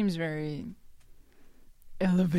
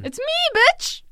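excited shout; 6 dB louder than usual. Real, not acted. Shouting out own name reveal.

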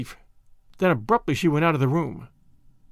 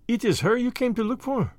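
put-on lightly accented and higher girl; French maid; 6 semitones higher than usual. A narrator's reading. The maid tells Tommy her place, light French accent.

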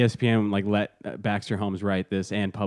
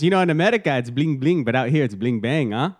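hammed-up bad African accent, pitched up at the start; terrible movie accent; 4 semitones higher than usual. Imitating a film smuggler for co-hosts, terrible African accent. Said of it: "a terrible accent of his terrible accent".

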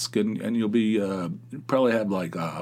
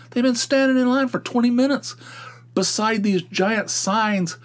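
fired-up rant; 11 semitones higher, 3 dB louder than usual. Real, not acted. Caller's host fired up about customers ignoring the signs, high and indignant. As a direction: stretch it out in disbelief.

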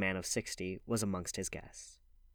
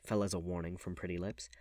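ordinary delivery, nothing marked

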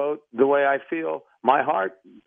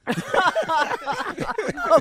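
high, hearty laugh. Real, not acted. Radio host laughs at the finished prank. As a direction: burst out laughing.

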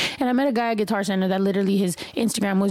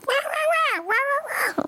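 mock yapping chihuahua wahs, far higher; chihuahua yelling; much slower than usual. Host imitating her own yelling on clips, chihuahua yaps. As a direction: yap like a little dog, much higher. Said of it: "sounding like a chihuahua".

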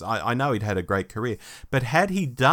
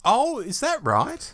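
surprised; 13 semitones higher, slower than usual. Real, not acted. Surprised at news about actress.

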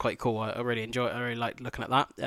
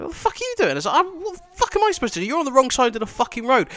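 high, loud indignant yell; mock outraged driver; 13 semitones higher, 6 dB louder than usual. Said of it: "I'm like".

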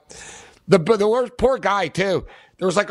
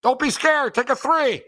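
encouraging shout; 8 semitones higher than usual. Pretend. Encouraging player from stands.

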